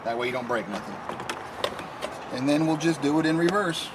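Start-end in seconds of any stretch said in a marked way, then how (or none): none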